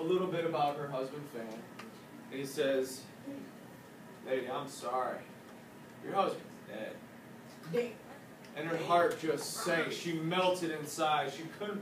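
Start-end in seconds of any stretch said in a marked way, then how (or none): 4.3-7.9 low, soft bad news; sorry. Laborer telling the wife her husband is dead, sorry. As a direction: break it to her gently, pitched down.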